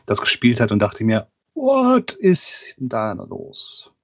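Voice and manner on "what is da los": very high, slow, drawn-out; baffled. Retold or imitated. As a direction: stretch it out in disbelief. Baffled thought about friend's game.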